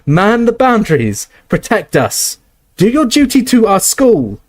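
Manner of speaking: rally the troops; rallying cry. Acted. McGonagall rallies the statues to defend the school, high.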